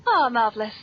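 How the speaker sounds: ordinary talk, nothing marked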